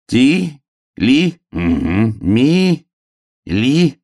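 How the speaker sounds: high hare sounding out syllables; slow, separated high syllables; reading syllable by syllable. A narrator's reading. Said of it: "стал читать по складам тряпочку".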